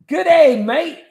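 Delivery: hammed-up Australian greeting; Australian accent. Greeting a viewer from down under with a mock Australian accent. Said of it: "Got a mate from down under".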